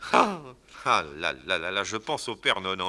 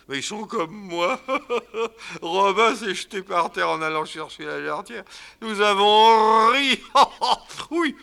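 put-on drunken laughing; 11 semitones higher, 6 dB louder than usual. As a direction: laugh tipsily while telling it. Drunk guest laughing about the wedding.

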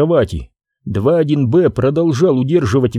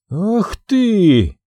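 angry; 7 semitones higher than usual. Acted. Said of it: "дать волю рвущемуся наружу гневу".

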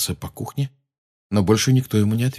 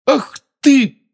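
angry outburst; 6 dB louder than usual. Acted. Harry about to curse.

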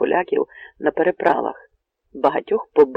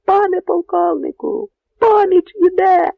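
high, loud and drawn-out cry; sentry announcing help is coming; sentry shouting; 14 semitones higher, 7 dB louder than usual. Acted. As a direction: yell the news from the belfry. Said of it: "гукнув чатовий на дзвіницях".

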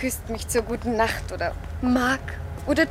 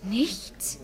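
a breathless whispered question; soft unvoiced rising question; 2 semitones lower, 9 dB quieter than usual. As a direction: whisper it, afraid of the answer. Anxious reply to the fortune teller.

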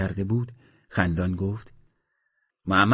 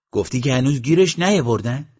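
louder, higher questioning voice; asked loudly; 5 semitones higher, 7 dB louder than usual. A narrator's reading. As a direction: call the question out so others hear. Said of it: "این را بلند گفت".

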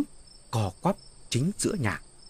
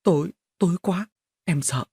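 timid higher-pitched wife's voice; trembling, frightened pleading; 4 semitones higher than usual. A narrator's reading. Scared wife begging husband to leave the light on, trembling. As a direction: plead haltingly, in small fearful bursts. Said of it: "Mụ run giọng bảo chồng".

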